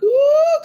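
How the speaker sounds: loud, high "ooh" outburst; spontaneous high exclamation; high whoop. Real, not acted. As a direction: let out a sudden piercing "ooh". Overcome exclamation before the teaching.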